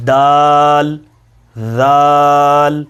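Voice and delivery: دال then ذال, each drawn out very slowly; slow letter pair. Shown as a demonstration. Teacher contrasts دال and ذال for students. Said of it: "آپ کی آواز اس طرح ہوگی".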